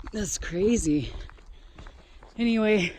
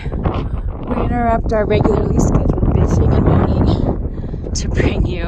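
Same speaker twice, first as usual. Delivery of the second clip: mock TV announcer; 4 semitones higher, 7 dB louder than usual. Mock broadcast interruption.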